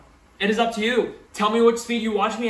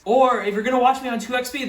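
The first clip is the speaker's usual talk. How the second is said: ordinary talk, nothing marked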